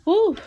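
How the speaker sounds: high, loud relieved exhale; loud shaky whew; big whew. Real, not acted. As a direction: blow out the emotion. Emotional release breath.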